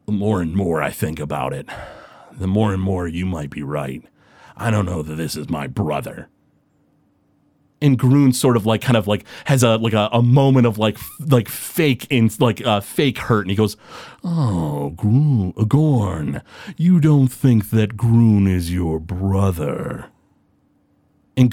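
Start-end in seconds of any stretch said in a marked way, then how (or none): 0.1-6.3 dazed brute's low voice, lost in pain; dazed Gorn. Acted. 14.2-20.1 low, slow, sneering fake hurt; villain faking hurt, low and sly; mock-hurt villain. GM voices Groon, mock hurt.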